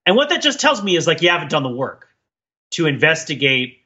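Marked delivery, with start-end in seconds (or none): none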